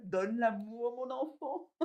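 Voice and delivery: high-pitched Creole-accented aunt imitation. Aunt telling her child to give love, high Creole accent. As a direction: lift it high in a Creole lilt. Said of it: "je reprends mon accent créole".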